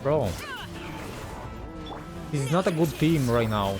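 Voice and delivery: ordinary talk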